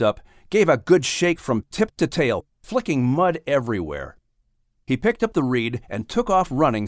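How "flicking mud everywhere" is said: usual speaking voice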